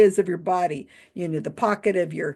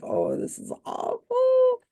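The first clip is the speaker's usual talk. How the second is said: high, slow, drawn-out groan of disbelief; cringing dismay; slower than usual. Retold or imitated. Watching the magician's trick flop, cringing high and slow. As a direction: stretch it out like you can't bear to watch. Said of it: "It was like".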